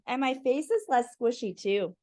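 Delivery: ordinary delivery, nothing marked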